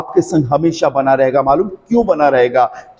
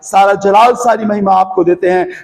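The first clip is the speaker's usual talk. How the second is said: prayer building into an urgent, higher-pitched plea, easing at the close; fervent prayer; 4 semitones higher than usual. Real, not acted. Preacher praying over his listeners for freedom and healing, fervent prayer. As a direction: pray in a pressing, unbroken stream of petitions.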